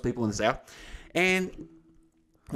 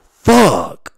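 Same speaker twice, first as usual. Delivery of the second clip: loud, higher cry of dismay; exasperated outburst; 5 semitones higher, 9 dB louder than usual. Retold or imitated. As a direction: blurt it out in mock despair. Acted dismay at going next.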